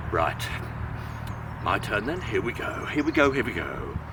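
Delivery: very low, quiet, resolute big goat; deep big billy goat. Acted. Big Billy Goat Gruff before crossing, very low voice. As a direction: rumble it as the biggest goat steps up. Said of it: "and said".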